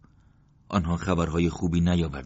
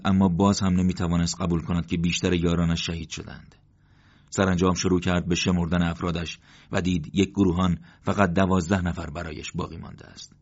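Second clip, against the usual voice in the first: ordinary delivery, nothing marked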